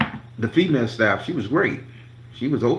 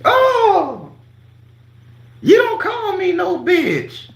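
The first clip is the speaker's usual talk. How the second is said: much higher, slow, sugary mock voice; sweet high voice; 7 dB louder, much slower than usual. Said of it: "Voice got real sweet".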